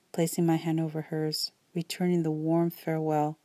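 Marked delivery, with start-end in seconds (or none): none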